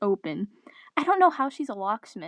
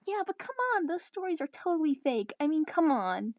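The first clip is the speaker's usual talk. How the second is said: put-on dismissive teen; 7 semitones higher than usual. Chloe dismisses the scary stories.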